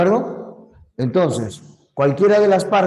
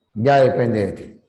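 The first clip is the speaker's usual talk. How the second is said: ordinary delivery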